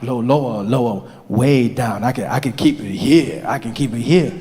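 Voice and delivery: deep, settled register. Acted. [name] finds his low voice for Mandela, deep. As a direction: drop way down and hold it there. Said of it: "Lower than that".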